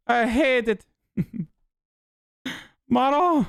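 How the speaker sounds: high, hammed-up fresh-off-the-boat Italian; Italian immigrant accent. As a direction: play a cartoonish Italian immigrant, high-pitched. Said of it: "and I'm an Italian immigrant".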